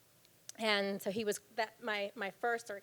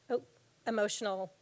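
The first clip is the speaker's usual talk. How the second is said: ordinary delivery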